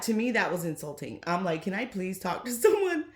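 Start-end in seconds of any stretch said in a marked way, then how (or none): 1.6-3.0 quoting herself with a laugh breaking in; laughing through words. Retold or imitated. Vlogger laughs retelling her call to the agent, laughing. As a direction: let a laugh burst mid-request.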